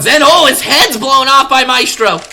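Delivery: sudden high, loud burst of excitement; excited call. Real, not acted. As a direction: yell out the headshot as it happens. Headshot call in live cast.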